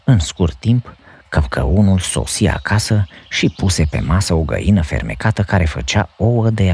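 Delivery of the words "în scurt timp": usual speaking voice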